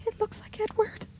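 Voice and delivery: high, hushed burst of terror; frenzy of fear. A narrator's reading. Rebecca naming the dead brother's shadow, frantic with fear. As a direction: blurt it out in panic. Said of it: "burst Rebecca in a sort of frenzy of fear".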